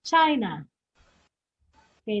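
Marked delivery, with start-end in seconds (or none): 0.1-0.6 "China" loud at first, then dropping; stress demo. Shown as a demonstration. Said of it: "So what is the correct answer?".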